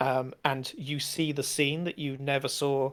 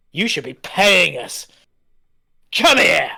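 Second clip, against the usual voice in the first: loud snarl, building to a yell; brigand shout; 3 semitones higher, 9 dB louder than usual. Acted. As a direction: grab her and bark it. Brigand lunging at her.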